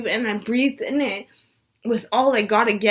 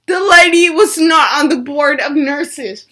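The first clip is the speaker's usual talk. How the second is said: louder, punched-out revelation; emphatic reveal; 3 semitones higher, 8 dB louder than usual. Real, not acted. Telling viewers the nurse was not on the board, emphatic. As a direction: drive the twist home loudly.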